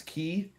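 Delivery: "ki" said with a hard k, like key; Japanese ki. Shown as a demonstration. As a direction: say "ki" the Japanese way, k plus ee. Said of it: "in Japanese".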